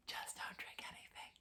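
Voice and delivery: unvoiced whisper, almost silent; whispered tip. Real, not acted. Recruit whispering a tip to future applicants. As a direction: pass the tip along like a secret.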